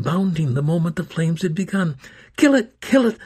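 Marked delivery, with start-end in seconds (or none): 2.4-3.2 high, loud frantic scream. A narrator's reading. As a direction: shriek the order. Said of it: "Amanda screamed".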